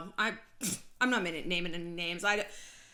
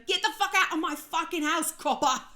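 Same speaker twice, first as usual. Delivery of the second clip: retold shout; 7 semitones higher, 5 dB louder than usual. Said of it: "shouting at him".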